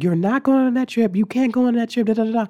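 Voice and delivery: high, loud nagging imitation; mock scolding mother. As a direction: imitate a mom laying down the law, high-pitched. Imitating mother banning the trip.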